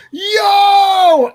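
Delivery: loud, high yo across the store; shouted yo. Retold or imitated. Big guy in the supermarket hailing him, loud yo. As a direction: call out to a buddy. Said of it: "and he goes".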